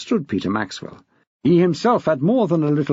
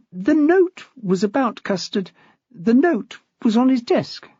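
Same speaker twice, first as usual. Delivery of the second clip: a touch higher, a hint of a worried young teacher; lightly voiced young woman; 10 semitones higher than usual. A narrator's reading. Anxious teacher reporting to the head, lightly voiced. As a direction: voice Nicole a little higher than the narration. Nicole's voice in the story.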